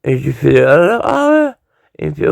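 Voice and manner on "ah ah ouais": mock surprise. Narrator reacts in disbelief.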